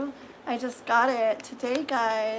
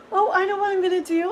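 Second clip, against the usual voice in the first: ordinary talk, nothing marked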